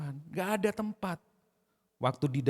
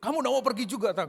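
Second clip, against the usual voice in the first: challenging; 7 semitones higher, 4 dB louder than usual. Acted. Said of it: "saya sendiri aja yang berasumsi".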